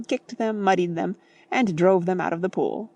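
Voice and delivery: usual speaking voice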